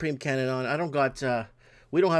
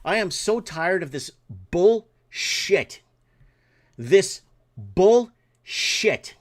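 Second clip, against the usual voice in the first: slow, dragged-out, louder angry words; furious rant; 2 semitones higher, 5 dB louder, much slower than usual. Real, not acted. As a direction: stretch every word out in real fury. Furious rant about insurance office.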